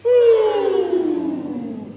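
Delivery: high, loud head-voice ooh; falsetto ooh. Shown as a demonstration. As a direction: sing a high hoot with the class. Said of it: "Try three with me".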